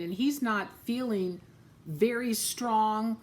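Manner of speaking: plain reading voice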